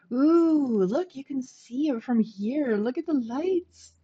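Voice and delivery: excited. Real, not acted. First sight of her lit-up city.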